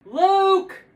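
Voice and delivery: high call from another room; yelling across the room. Acted. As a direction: call his name from afar. Mom calling son.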